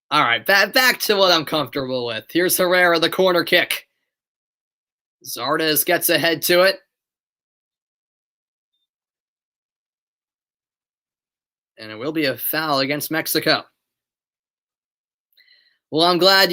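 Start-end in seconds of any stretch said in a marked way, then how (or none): 2.3-13.6 live match commentary voice; steady broadcast commentary; play-by-play. Acted. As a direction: call the game as it happens.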